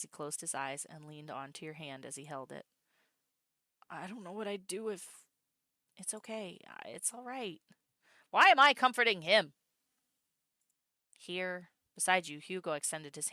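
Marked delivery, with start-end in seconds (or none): 3.9-7.6 gentle comfort. Acted. Ella comforts Soul. 8.3-9.5 exasperated exclamation. Real, not acted. Streamer exclaims.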